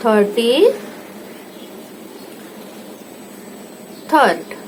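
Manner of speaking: थर्टी, a long pause, then थर्ड; थर्टी थर्ड said in two halves; split for dictation. Shown as a demonstration. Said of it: "अब थर्टी थर्ड".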